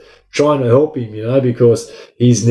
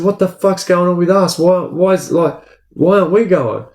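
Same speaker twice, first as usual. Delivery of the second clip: imitating panicked inmates, pitched higher; retold frantic questions, higher voice; alarmed inmates; 7 semitones higher than usual. Other inmates confused, asking why.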